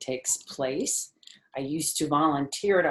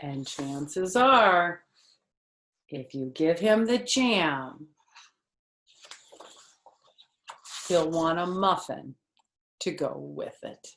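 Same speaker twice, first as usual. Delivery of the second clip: put-on reading to children; 4 dB louder, slower than usual. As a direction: read it brightly to a little listener. Reading picture book aloud.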